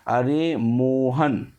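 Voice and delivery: अरे Mohan slowly drawn out, not shouted; stretched distant call. Shown as a demonstration. Teacher demonstrating calling Mohan, अरे Mohan stretched. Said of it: "दूर से बुलाने में किया जाता है".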